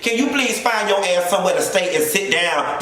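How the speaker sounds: ordinary delivery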